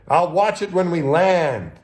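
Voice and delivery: high, loud and slow reply; called-back refusal. Retold or imitated. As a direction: call back a firm no across the ship. Refusing crewmate's call to come.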